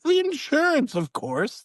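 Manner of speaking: squeaky matter-of-fact. Retold or imitated. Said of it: "I'm always like".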